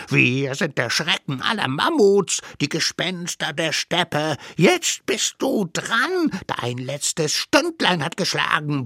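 menacing little monsters. Acted. Little savages threatening the mammoth with its end, menacing voice. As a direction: act like terrors of the steppe.

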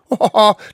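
loud, high mock laugh; short burst of dismissive laughter; scoffing laugh. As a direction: laugh the charge away. Accused scoffs at the charge.

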